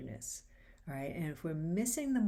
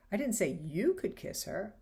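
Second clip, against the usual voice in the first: you stressed, as if to another person; 3 dB louder than usual. Shown as a demonstration. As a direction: point at you. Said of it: "you can change the meaning or tone of the line".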